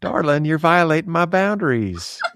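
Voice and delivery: way up high, sugary and prim; a lady politely scolding a guest. Pretend.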